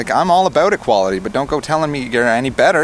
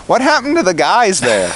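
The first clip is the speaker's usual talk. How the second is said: incredulous challenge; 7 semitones higher, 5 dB louder than usual. Retold or imitated. Retold challenge in an argument.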